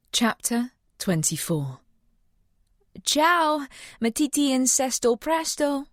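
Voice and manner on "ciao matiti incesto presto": called out high in Italian; calling out. Acted. Audrey to the sick dog, calling out high and cheerful. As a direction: call cheerfully to the leaving dog. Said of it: "Audrey called to the ailing dog".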